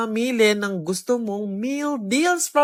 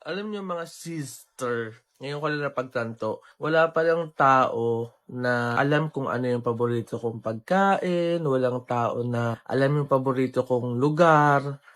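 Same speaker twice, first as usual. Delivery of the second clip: mock melancholy; 10 semitones lower, 5 dB quieter than usual. Dramatic sad farewell.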